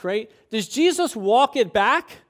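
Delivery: ordinary talk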